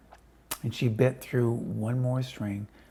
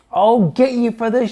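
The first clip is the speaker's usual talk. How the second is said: high, loud raging threat; enraged old hag yelling; furious shout; 11 semitones higher, 13 dB louder than usual. A narrator's reading. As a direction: shout down at him in fury. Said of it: "shouted Missus Twit".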